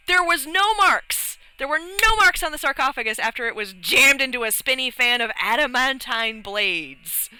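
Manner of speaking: high, emphatic disbelief, easing down at the end; incredulous protest. Retold or imitated. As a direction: protest the sheer impossibility of it. Indignant disbelief at unmarked sarcophagus.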